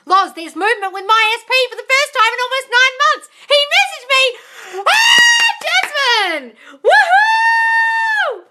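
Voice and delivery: high, loud celebratory shouting, building to a drawn-out woohoo; real elation. Real, not acted. Coach cheering a client's breakthrough on a live, elated. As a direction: shriek with joy, stretching the cheers out.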